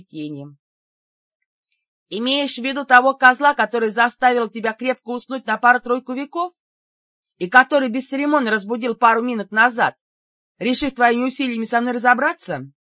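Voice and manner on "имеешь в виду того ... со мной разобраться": loud, higher contemptuous jeer; scornful taunt. Acted. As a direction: sneer at the absent master. Heroine scornfully mocks the master.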